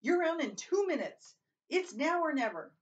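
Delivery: loud, high urgent call; shouting from the door. A narrator's reading. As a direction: shout a warning through the doorway. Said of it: "shouting from the door".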